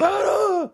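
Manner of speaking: high mock cries of pain; imitated pain yelp. Father as his son pulls the nail, high yelps. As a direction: yelp high, acting out the hurt.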